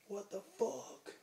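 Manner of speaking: high, drawn-out disbelief; retold incredulous bouncer. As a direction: imitate a bouncer looking up in disbelief, stretching it out. Bouncer's startled challenge, retold.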